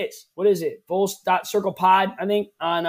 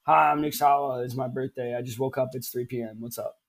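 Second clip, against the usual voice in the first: low, sleepy, mumbling just-woke-up voice; drowsy groggy guy; Nick Sawa impression; 5 semitones lower, 4 dB quieter than usual. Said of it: "this is my impression of Nick Sawa on his birthday".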